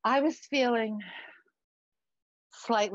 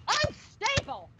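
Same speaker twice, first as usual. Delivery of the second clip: UNSTABLE shrieked high and drawn out; incredulous, indignant high squeal; outraged echo; 12 semitones higher, slower than usual. Acted. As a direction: throw the insult back in a high shriek. Mother echoes son's insult, outraged.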